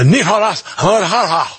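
Nicaragua said with a high, slow Spanish accent; mock Spanish accent on Nicaragua, drawn out. Shown as a demonstration. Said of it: "I have to do that in a Spanish accent".

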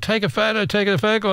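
high, hushed, hurried "take a photo"; a husband nudging his wife toward a dragon; urgent mock urging.

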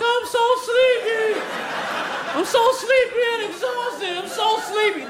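very high, drawn-out mock wail; crying sleepy baby. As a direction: whine like an exhausted baby, hammed-up. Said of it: "he's crying because he's sleepy".

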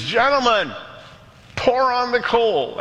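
high, stretched-out captain's order; captain rising to the challenge; rallying captain. Retold or imitated. Rival captain takes up challenge.

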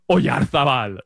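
slow, deliberate Spanish pronunciation of the name; Spanish accent. Real, not acted. Pundit revealing his pick for Spain, Spanish accent. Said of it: "I'm saying it with a Spanish accent".

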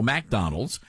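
McDonald's with a MAC start, as in "mack"; his own pronunciation. Shown as a demonstration. Host shows how he says it, McDonald's as MAC-Donald's. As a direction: say "McDonald's" opening on a clear "mack". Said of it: "some words that I say".

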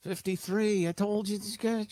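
high, put-on royal voice; lightly voiced pompous king; mock king. Host playing the King of England to his co-host, mock royal.

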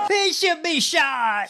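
high, drawn-out mock holler; imitated heckler's yell. Co-host echoing the rally clip, in a high mock yell. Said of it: "someone yelling".

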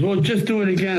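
high, offhand sound man; sound man's voice. Retold or imitated.

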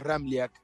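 "Ramliak" with the J said as an I; correct surname pronunciation. Shown as a demonstration. Guest correcting the host on his surname, proper pronunciation. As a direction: say "Ramliak" with a gliding "li-ak" ending. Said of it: "bien pronunciado el apellido es".

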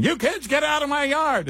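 put-on high cranky old-man yell; a cranky geezer shouting at kids; grumpy old man. Old radio host yelling at neighborhood kids, cranky high voice. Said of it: "keep screaming".

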